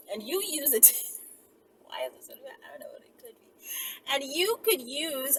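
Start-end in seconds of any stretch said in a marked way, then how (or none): 1.9-3.5 whisper. Real, not acted. Giggling at the question.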